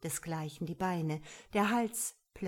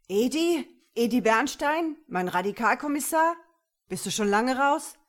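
loud, higher calls of surprised recognition; robber calling back; 5 semitones higher, 9 dB louder than usual. A narrator's reading. Robber recognising the commissioner across the square, calling out. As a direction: shout questions back to an old acquaintance.

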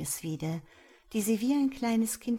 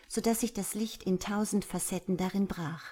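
ordinary delivery

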